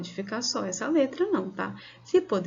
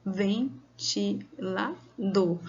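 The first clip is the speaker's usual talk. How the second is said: Ventilador split into four slow beats, ven-ti-la-dor; syllable by syllable; much slower than usual. Shown as a demonstration. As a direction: count out Ventilador very slowly, syllable by syllable. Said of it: "Você falou quatro vezes".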